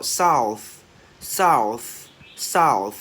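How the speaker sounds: "south" loud, with a hissed TH at the end; teacher's emphatic model of "south"; loud released TH. Shown as a demonstration. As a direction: belt out "south", releasing air on the TH. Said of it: "bật càng to thì càng hay".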